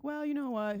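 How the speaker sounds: breezy mock shrug; carefree brush-off. Acted devil-may-care self-talk.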